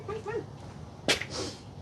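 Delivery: slow, high, breathy whisper; whispered coaxing. Real, not acted. Owner whispering to her scared dog, slow and soft.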